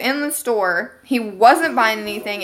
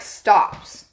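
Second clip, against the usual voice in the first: ordinary talk, nothing marked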